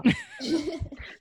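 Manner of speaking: high burst of real laughter; amused laugh at the slip. Real, not acted. Laughs at the cracking voice. (risata divertita)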